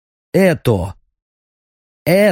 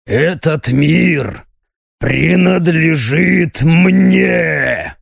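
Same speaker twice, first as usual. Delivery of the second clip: slow, shouted demon roar; demonic roar; much slower than usual. Acted. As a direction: roar it out like a monster. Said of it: "Ариман уже почти рычал".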